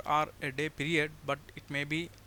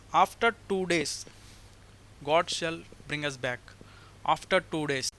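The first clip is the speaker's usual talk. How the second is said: preaching. Acted. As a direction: proclaim each verse to the congregation.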